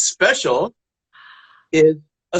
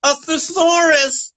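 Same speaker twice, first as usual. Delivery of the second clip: punchline; 7 semitones higher, slower than usual. Acted. Punchline delivered slowly.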